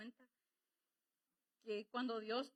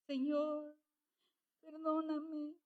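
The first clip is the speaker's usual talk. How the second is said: a higher, louder cry of Señor, perdóname; pleading prayer; 4 semitones higher, 4 dB louder, slower than usual. Retold or imitated. Praying woman to God, pleading for forgiveness.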